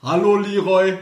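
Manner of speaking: put-on Russian-accented greeting, high; mock Russian accent, Hallo Leroy; russian accent in german. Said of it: "Weil Schweden immer mit russischem Akzent reden".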